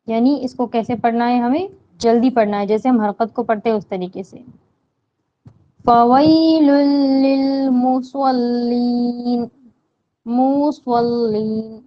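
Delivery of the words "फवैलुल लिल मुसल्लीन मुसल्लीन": slow, drawn-out Quranic chant; Quran recitation. Shown as a demonstration. Teacher reciting the verse for students, slow chant. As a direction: recite each word slowly, holding the long vowels.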